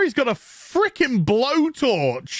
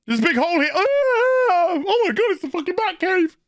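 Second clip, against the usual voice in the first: mock surprised faller; 10 semitones higher, much faster than usual. Mocking someone falling into the Batcave.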